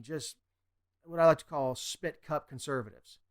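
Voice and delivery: usual speaking voice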